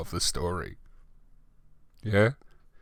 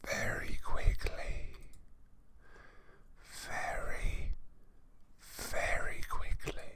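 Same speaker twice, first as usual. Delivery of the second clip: breathy whispered aside; 10 dB quieter, slower than usual. Real, not acted. Reader whispering to the audience before the chapter. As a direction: whisper it under your breath.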